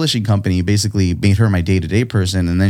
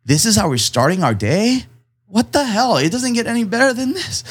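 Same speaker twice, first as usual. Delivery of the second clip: overwhelmed; 13 semitones higher than usual. Retold or imitated. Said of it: "And I'm fighting tears".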